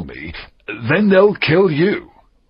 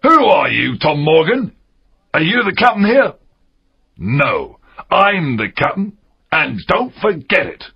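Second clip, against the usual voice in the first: raised, high-pitched challenge; angry pirate captain; 9 semitones higher, 4 dB louder than usual. Acted. Silver rebukes Tom Morgan, angry and commanding. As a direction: put the mutineer in his place.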